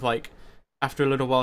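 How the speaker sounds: ordinary talk, nothing marked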